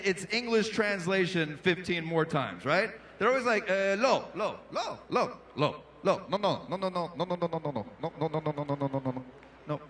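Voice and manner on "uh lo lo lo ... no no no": rapid Israeli lo-no chant, growing softer; repeating Israeli. Retold or imitated. Comic mimics Israelis repeating Hebrew then English. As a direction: rattle the word off many times, trailing away. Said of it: "They'll say a Hebrew word, like, eight times".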